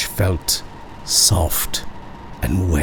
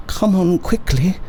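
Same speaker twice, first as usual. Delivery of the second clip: higher-pitched, hurried-on urging with nerves underneath; nervous urging; 11 semitones higher than usual. A narrator's reading. Woman hurrying the children out of the museum, nervously urging. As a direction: urge them along in a raised, anxious pitch. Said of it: "pretending that only the children were nervous".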